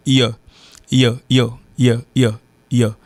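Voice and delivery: "yo" as a short unstressed "yuh"; reduced you. Shown as a demonstration. Teacher explaining fast English, "yo" for reduced you. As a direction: cut you down to a quick "yo". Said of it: "Donc, il sorti".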